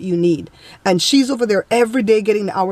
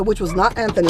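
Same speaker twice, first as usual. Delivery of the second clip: ordinary talk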